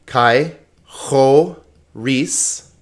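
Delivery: "Kai ho ris" very slow, with gaps; syllable by syllable. Shown as a demonstration. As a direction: sound out "Kai ho ris" one piece at a time. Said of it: "on to the pronunciation".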